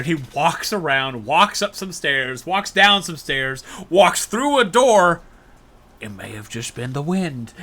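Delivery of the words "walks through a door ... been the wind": comic narration. Retold or imitated. Narrating guard's comic search.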